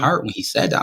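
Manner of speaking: ordinary delivery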